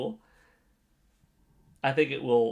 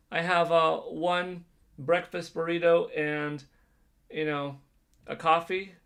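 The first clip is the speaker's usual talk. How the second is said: drive-through worker voice; 6 semitones higher, 5 dB louder than usual. Pretend. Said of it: "so when they say".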